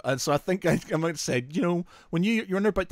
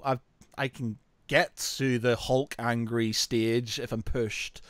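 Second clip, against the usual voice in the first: usual speaking voice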